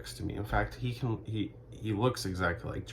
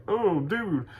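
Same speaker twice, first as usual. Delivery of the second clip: high, cocky mocking bro voice; pitched-up taunting impression of a cocky bro; mock Jonah Hill; 8 semitones higher than usual. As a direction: sneer it up high like a smug jerk at the bar. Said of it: "and he's like".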